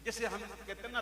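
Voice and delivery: ordinary delivery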